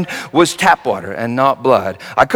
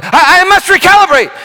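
a lightly voiced panicked technician, raised high; mock alarmed technician; 13 semitones higher, 4 dB louder than usual. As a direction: technician yells out a fake emergency, pitched up. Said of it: "She yelled out".

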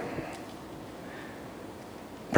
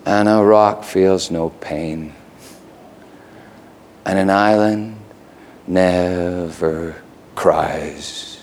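slow, hushed lyric recitation; poem recital; slower than usual. Acted. Preacher reciting "I Am a Rock" lyrics, slow and hushed. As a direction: let the lines fall away slowly.

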